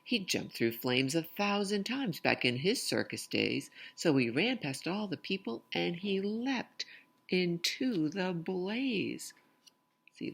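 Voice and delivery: lively, sing-song storytelling voice; picture book read-aloud. Acted. Reading a rhyming picture book aloud to children, lively sing-song. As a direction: bounce the rhymes for young listeners.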